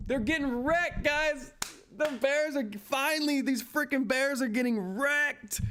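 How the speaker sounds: high, triumphant taunting; gleeful gloating. Real, not acted. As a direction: gloat over the losing Bears. Gloating over short sellers losing.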